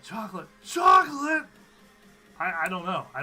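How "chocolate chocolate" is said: high, loud cartoon yell of chocolate; shouted chocolate imitation; mock yell. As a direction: yell it like the SpongeBob salesman. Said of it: "and he yells".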